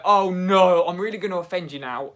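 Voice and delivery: ordinary talk, nothing marked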